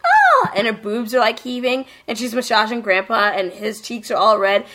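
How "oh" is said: squeaky high swoon, louder. Retold or imitated. Friend retelling the blushing vampire, high squealing swoon. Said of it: "Elfriede's like".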